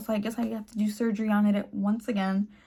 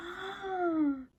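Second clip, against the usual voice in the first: gasp; 7 semitones higher, 9 dB quieter than usual. Retold or imitated. Shocked by intro.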